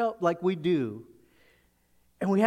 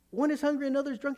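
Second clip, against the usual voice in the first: high, fast, disbelieving re-read; Paul can't believe what he's hearing; incredulous repeat; 8 semitones higher, much faster than usual. Acted. Preacher voicing Paul hearing the Corinthian news, incredulous.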